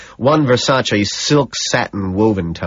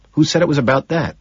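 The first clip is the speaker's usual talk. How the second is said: quick, much higher-pitched question; insistent repeat; 7 semitones higher, faster than usual. A narrator's reading. Man repeats his challenge to colleague, high and insistent. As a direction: push the pitch right up and ask quickly. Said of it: "he asks again".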